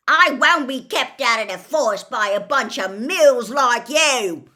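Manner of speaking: loud, defiant half-giant shout. A narrator's reading. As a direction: bellow it at the centaurs. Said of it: "said Hagrid loudly".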